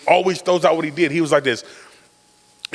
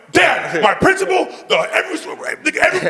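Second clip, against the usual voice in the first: high, spluttering mock protest; exasperated son; 13 semitones higher than usual. Son protesting to dad in front of the principal, hammed-up exasperation. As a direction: plead with dad, words breaking off.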